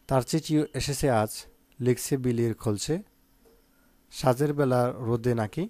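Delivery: put-on slow, measured reading of a children's poem; শিশুদের কবিতা ধীরে আবৃত্তি; poem recitation. Shown as a demonstration. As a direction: recite it line by line, slow and even. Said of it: "আমি বলবো এই কবিতাটি শিখবা".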